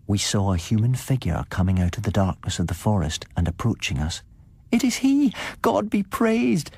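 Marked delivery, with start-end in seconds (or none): 4.7-6.7 high, slow cry of relief; joyful cry. A narrator's reading. Sedenka crying out as her father returns, high and joyful. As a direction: cry out to heaven in joy. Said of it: "cried Sedenka".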